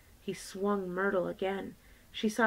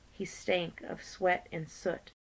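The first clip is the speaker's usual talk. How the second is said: ordinary delivery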